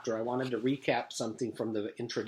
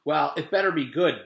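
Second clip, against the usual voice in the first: warning; 4 semitones higher, 6 dB louder than usual. Acted. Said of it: "Beebe warned".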